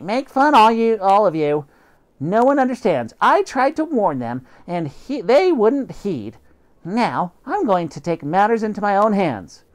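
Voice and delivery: high, loud wounded indignation; high huffy cat voice, resolute; indignant cat. Acted. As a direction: rant that no one listens. Cat vows revenge.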